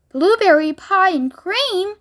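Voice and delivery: slow, high gleeful cry; excited shout. A narrator's reading. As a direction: announce it with glee. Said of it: "shouted Violet".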